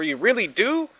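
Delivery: high, hopeful question; hardly believe the good news; surprised. Acted. Lonely dinosaur hearing the invite, high hopeful.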